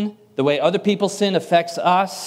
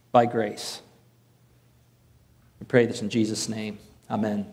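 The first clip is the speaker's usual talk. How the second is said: hushed, quieter closing prayer; 5 semitones lower, 3 dB quieter than usual. Acted. Pastor praying over the congregation before the sermon, hushed prayer. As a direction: pray over the room, soft and low.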